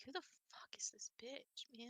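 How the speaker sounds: hushed, rough whisper. Retold or imitated. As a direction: whisper it in alarm. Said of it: "they're like".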